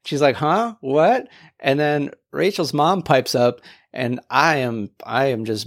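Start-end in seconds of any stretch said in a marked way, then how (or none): 0.4-1.3 higher-pitched puzzled questions; mock-puzzled, rising; imitated bafflement. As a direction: act out a clueless friend's confusion. Acts friend baffled by the joke.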